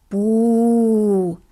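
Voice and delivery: grumbled boo. Acted. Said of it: "brummte Philipp".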